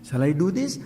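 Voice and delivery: rising, tempted "Shall I do this?" in a normal voice; eager half of the inner debate; hopeful question. Acted. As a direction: ask it as the side that wants to. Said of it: "Hamletian human conflict".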